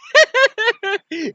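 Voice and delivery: very high, loud doll cackle; hammed-up villain laugh; mocking cackle. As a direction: cackle shrilly at the opponent. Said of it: "and it was like".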